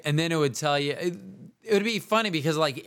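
ordinary talk

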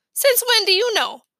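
high startled question; surprised. Acted. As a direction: blurt it out in disbelief. Peter shocked by Fudge.

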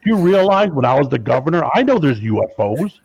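hammed-up Jesse the Body Ventura growl; phone prank as the former governor, boastful; Jesse Ventura impression. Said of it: "I'm like".

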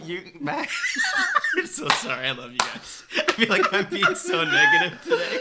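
high laughing apology. Real, not acted. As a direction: giggle through the words.

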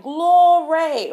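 loud, high, drawn-out praise shout; hammed-up, belted cry of praise; rejoicing congregation. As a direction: imitate worshippers crying out in church. Imitates disciples shouting praise.

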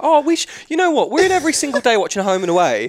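high, loud mocking voice; mock the lockdown crowd; mocking beachgoers. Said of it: "everyone's down at the beach like".

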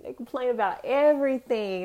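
usual speaking voice

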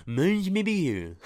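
silly, garbled fake French; mock an over-the-top French pronunciation; exaggerated French accent. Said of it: "You don't have to say".

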